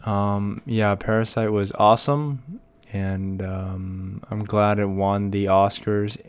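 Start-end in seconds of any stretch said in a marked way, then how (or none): none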